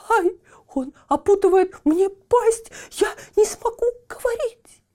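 very high, strangled yelp; frantic, choked dog cry; dog being muzzled. A narrator's reading. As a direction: squeal as if the jaws are being tied. Dog's muzzle is being bound.

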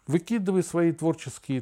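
usual speaking voice

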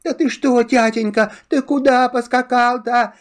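put-on high child voices, puzzled; little boys asking. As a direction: ask like small sons, wide-eyed. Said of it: "А мальчики опять".

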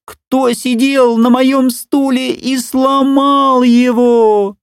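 very high, slow squeaky cub. A narrator's reading. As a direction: squeak it like a tiny bear cub. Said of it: "и пропищал". (писклявый голосок медвежонка)

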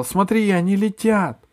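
high, loud alarmed shout; shouting girl. A narrator's reading. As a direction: cry out in alarm. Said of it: "вдруг не закричала".

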